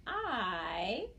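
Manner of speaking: "I" held long; isolated sound. Shown as a demonstration. Teacher segments shy with children, "I" held long. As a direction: draw out the vowel "I" on its own. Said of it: "Pull down the circles with me".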